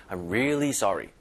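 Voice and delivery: really pushed and stretched a little; stressed, drawn out really. Shown as a demonstration. Teacher shows learners how to stress really. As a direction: lean on really and drag it slightly. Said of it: "힘을 주면서 살짝 끌어주는 거예요".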